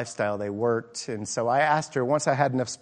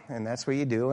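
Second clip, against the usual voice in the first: ordinary talk, nothing marked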